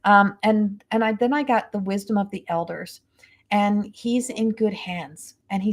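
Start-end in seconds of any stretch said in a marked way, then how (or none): none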